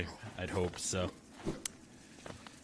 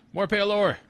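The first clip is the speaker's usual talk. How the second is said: high, loud cry of discovery; excited exclamation; 12 semitones higher, 8 dB louder than usual. Real, not acted. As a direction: call it out, thrilled at the find. Finds rare item in game.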